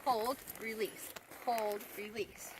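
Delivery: slow, even beats on hold and release; slow rhythmic cue. Shown as a demonstration. Trainer showing viewers the slow hold-release timing. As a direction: count it out slowly like a rhythm. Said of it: "I want".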